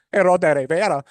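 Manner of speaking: mock Spanish accent on the name; overdone reporter's Spanish accent; exaggerated Spanish accent. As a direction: roll into a showy Spanish accent. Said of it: "they break into that accent".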